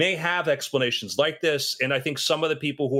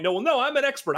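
high, smug know-it-all; mock expert; 10 semitones higher, faster than usual. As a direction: parrot the self-appointed expert, pitched up. Mocking self-declared experts.

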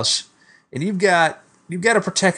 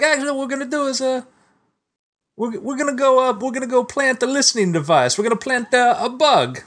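higher, lightly voiced Italian officer; a hint of an Italian cop briefing; Italian cops' voice; 10 semitones higher than usual. GM voicing Italian cops briefing the players, higher pitched. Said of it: "they're like".

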